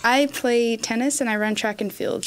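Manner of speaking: usual speaking voice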